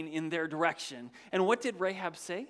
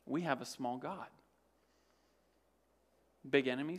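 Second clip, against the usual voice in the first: ordinary talk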